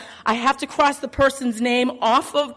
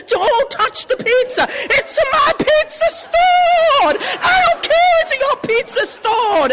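opera-style shouting match; 11 semitones higher than usual. Retold or imitated. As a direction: scream it like a family opera fight. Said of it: "it turns into an opera".

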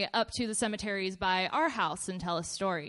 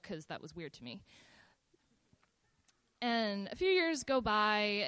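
ordinary delivery

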